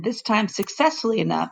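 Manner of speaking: usual speaking voice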